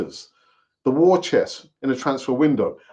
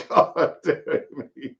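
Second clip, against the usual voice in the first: laughing at himself; 6 dB quieter, slower than usual. Real, not acted. Laughs at himself mid-vlog.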